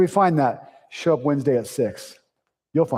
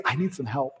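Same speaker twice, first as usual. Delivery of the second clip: soft admission; 2 semitones lower, 8 dB quieter than usual. Shown as a demonstration. Pastor contrasting pride with a soft plea. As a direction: let it out quietly. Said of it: "The strongest thing you can say is".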